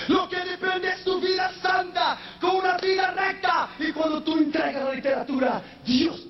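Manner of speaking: high sung preacher's shout; preaching at full force. Acted. Preacher urging the church toward holy living, sung shout. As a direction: chant it out with fire. Sermon climax.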